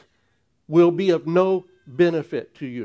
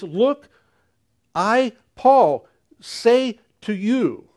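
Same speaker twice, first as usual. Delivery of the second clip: Paul's voice, higher and slow, weighing each word; emphatic Paul; 4 semitones higher, slower than usual. Retold or imitated. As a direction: announce yourself with apostolic weight, slowly. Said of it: "an emphatic speech for Paul".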